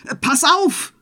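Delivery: a tipsy tailor shouting a warning across the inn. Acted. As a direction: yell a quick warning.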